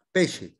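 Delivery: Italian "sh" inside pesce. Shown as a demonstration. Teacher gives student a second example, pesce with "sh". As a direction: model pesce with sc said as "sh". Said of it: "ese es un sonido que suena sh".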